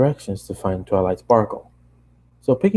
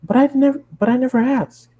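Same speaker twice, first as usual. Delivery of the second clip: bubbly, much higher cartoon pony voice; high Pinkie voice. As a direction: chatter it up high like an excited pony. Pinkie voiced, retake follows.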